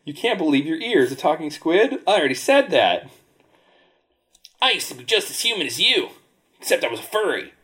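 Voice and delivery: high sea creature voice; lightly voiced squid.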